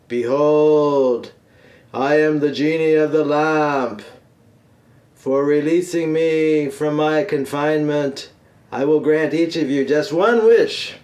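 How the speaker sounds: booming, drawn-out genie proclamation; grand genie. Acted. Genie of the lamp to the men who freed him, grand proclamation. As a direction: announce it grandly, like a mighty genie.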